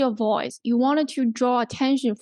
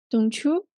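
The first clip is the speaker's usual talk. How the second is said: "Don't you" rising at the end; rising tag question. Shown as a demonstration. Teacher repeats the tag question, rising "Don't you". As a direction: lift "you" upward to ask for confirmation. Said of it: "So a rising intonation".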